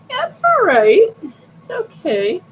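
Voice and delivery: high singsong "it's okay"; retold reassurance. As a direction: brush it off in a high, easy lilt. Retold reassurance to departing doctor.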